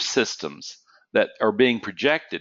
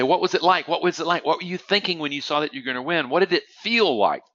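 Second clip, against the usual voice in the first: imitating reporters, higher and pressing; eager journalists; 7 semitones higher than usual. Journalists crowding the athlete with microphones, mock eager. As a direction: fire questions at the winner like a reporter. And they say.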